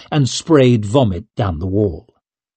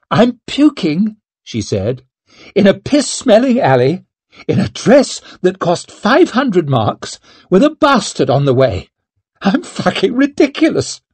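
half-laughing at herself, higher; chuckling; 8 semitones higher than usual. A narrator's reading. Noblewoman in an alley laughing at herself. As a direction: giggle through the words at your own mess. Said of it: "she started to chuckle".

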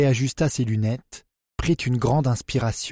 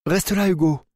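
cry out; 6 semitones higher than usual. A narrator's reading. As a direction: call Hugo back sharply. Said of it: "cria Naïma".

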